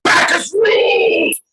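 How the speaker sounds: high, slow roaring cry; triumphant shout. Acted. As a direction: bellow it like a drunk god. Drunken god voiced, shouting.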